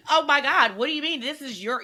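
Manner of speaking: high, loud mock disbelief; high-pitched imitated shock; astonished. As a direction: burst out, stunned by her calm. Imagined reaction to calm mother.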